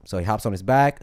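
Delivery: normal talking voice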